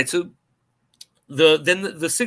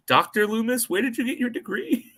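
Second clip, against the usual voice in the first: high, sneering question; mock a quack doctor's credentials; mocking patient voice; 10 semitones higher than usual. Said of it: "It's like".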